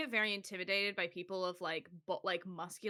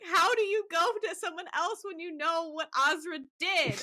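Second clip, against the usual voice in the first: mock dismay; 10 semitones higher, 4 dB louder than usual. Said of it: "I'm like".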